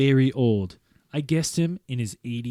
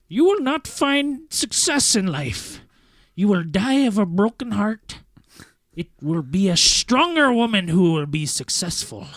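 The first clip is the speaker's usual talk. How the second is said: put-on old man voice; 7 semitones higher, 4 dB louder than usual. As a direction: pronounce doom like an ancient seer. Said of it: "you have to do an old man voice".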